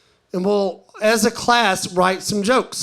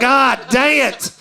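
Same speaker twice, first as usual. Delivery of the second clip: laughing exasperation; 4 semitones higher, slower than usual. Retold or imitated. Another crude topic.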